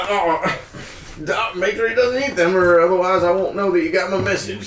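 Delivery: high anxious plea; worried farmer. Acted. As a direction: beg them to spare the bird. Farmer pleads about pigeon.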